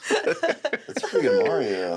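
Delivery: high cartoon Italian voice; high Italian accent; mock Mario. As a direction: compliment as the plumber. Praise in a Mario voice.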